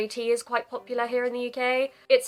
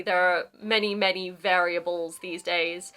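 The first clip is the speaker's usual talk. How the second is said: ordinary talk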